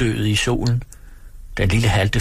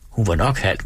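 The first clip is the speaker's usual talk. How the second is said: plain reading voice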